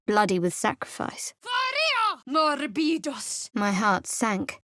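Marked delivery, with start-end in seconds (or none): 1.4-2.2 much higher, held ringing call; spell cry. Acted. Cleric unleashing a spell, ringing cry. 2.3-3.5 slow, higher invocation; spell incantation. Acted. Cleric cursing a foe, slow invocation.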